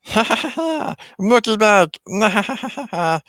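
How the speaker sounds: very high shrieking cackle; manic alien laugh. Retold or imitated. Kid retelling the movie as Stitch escapes, manic high cackle. Stitch taunting police ships.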